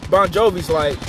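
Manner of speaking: normal talking voice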